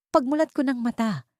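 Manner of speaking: plain reading voice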